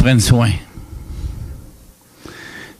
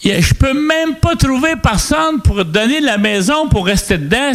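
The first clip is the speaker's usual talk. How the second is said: higher-pitched voice of an exasperated woman on the phone; 8 semitones higher than usual. Retold or imitated. Woman complaining on the phone about the house, exasperated. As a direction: imitate her complaining in a raised pitch.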